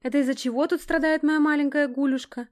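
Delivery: mock-tender roar. A narrator's reading. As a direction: bellow a teasing endearment from behind. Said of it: "проревел за моей спиной Геныч".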